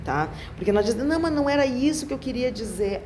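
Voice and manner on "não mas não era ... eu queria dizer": higher-pitched insistent backtracking; protesting denial. Acted. Speaker denying what their intonation said, protesting. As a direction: take it back in a raised, defensive tone. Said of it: "A tua entonação vocal disse isso".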